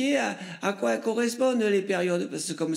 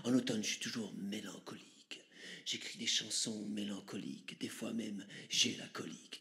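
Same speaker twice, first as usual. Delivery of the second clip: whisper; 7 semitones lower, 12 dB quieter than usual. Acted. Shaman whispering confession.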